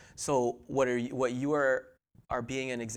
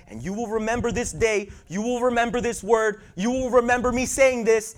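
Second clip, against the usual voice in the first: raised, loud preacher's voice; preaching at full force; 8 semitones higher, 8 dB louder than usual. Acted. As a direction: preach it high to the congregation.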